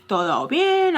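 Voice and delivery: high-pitched imitation of a check-in; imitated caller's query. Caller checking in on Alexis, high imitated question. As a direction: ask it high, as a retold caller.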